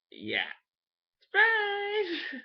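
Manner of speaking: high, loud squealed "surprise!"; spring it on her like a party reveal; mock surprise reveal. Teasing his coworker as he comes out, high excited squeal.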